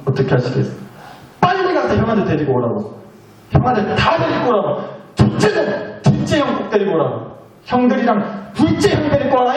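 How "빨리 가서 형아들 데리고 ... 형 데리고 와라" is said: a parent's mock stern order, rising on 둘째; angry parent. Mother ordering the children to fetch the culprit, mock stern. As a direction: bark for the brothers half playfully. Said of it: "이렇게 말하지 않으시겠어요".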